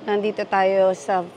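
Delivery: ordinary talk, nothing marked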